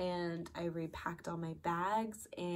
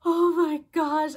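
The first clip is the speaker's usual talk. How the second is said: loud, high, drawn-out exclamation; overwhelmed relief; 10 semitones higher, 15 dB louder than usual. Retold or imitated. Trainee hugged by her trainer after passing, loud overwhelmed relief. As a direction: re-enact bursting out with relief, slow and loud.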